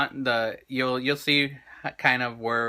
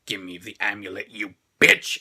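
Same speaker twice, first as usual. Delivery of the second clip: Dracula; 5 semitones lower than usual. Retold or imitated. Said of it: "when it was a Dracula grabs Phoebe".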